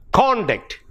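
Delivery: "Conduct" as CON-duct, high start then falling; stress on first syllable. Shown as a demonstration. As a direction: say "Conduct" as a noun, hit CON. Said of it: "if it is in the first syllable".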